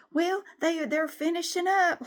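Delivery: high, breezy mock voice; office lady. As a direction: sing it up high like nothing's wrong. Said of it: "She said that".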